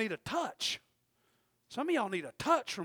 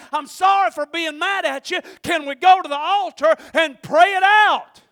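shouting, higher-pitched sermon climax; preaching; 7 semitones higher, 13 dB louder than usual. Acted. Preacher calling the church to forgive, shouting. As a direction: drive it home to the church.

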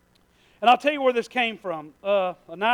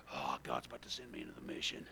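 much lower, mock-dramatic soldier voice; mock heroic warrior; 19 dB quieter, faster than usual. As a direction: ham up a would-be action hero. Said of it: "y'all are gonna be like".

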